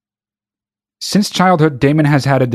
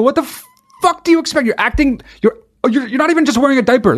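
exasperated outburst; 8 semitones higher, faster than usual. Real, not acted. Ranting at the adult baby.

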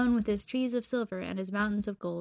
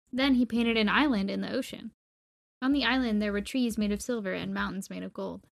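ordinary delivery

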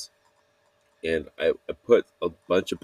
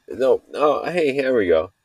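excited; 7 semitones higher, 4 dB louder than usual. Real, not acted. Getting into the story.

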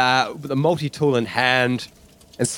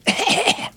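short coughing fit; acted cough. Boris amid steam and smoke, coughing.

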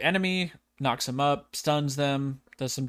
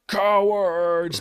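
loud, raised taunting call; mock battle yell; 5 semitones higher, 6 dB louder than usual. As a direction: call it out like a game champion. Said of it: "He'll be like".

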